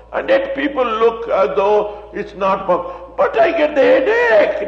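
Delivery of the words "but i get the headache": high, mocking complaint of a doubter; mock-whiny churchgoer. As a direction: mimic the doubter's whine, much higher. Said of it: "they say".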